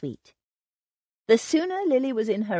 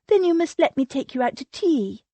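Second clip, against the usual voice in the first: higher, eager voice of the fussy cousin, apologizing in a rush; an anxious society lady pleading to be forgiven, pitched higher; gushing Sylvia; 7 semitones higher, 4 dB louder than usual. A narrator's reading.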